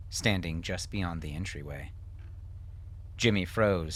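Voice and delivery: usual speaking voice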